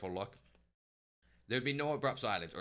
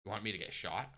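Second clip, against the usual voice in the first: usual speaking voice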